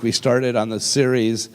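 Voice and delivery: usual speaking voice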